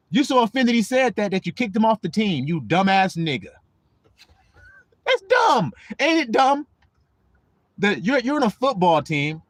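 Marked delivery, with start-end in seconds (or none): none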